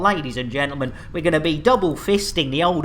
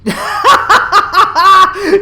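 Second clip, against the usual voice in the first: loud laughter; 11 semitones higher, 7 dB louder than usual. Real, not acted. Clip plays, streamer cracks up.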